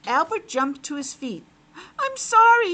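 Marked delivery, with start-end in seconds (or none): 2.0-2.7 high, loud startled little apology; piglet apologizing. Acted. Startled piglet apologizes to the frog, high and loud. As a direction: blurt a quick sorry like a startled piglet. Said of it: "he said".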